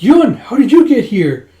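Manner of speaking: high, loud, slow amazed question; stunned he found them; surprised question. Acted. Yona asks Yun how he got there.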